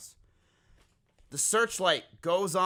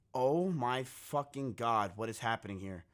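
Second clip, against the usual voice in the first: stunned disbelief; 6 semitones lower, 7 dB quieter than usual. Retold or imitated. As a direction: draw it out softly, dropping lower as it sinks in. Retold stunned reaction to the news.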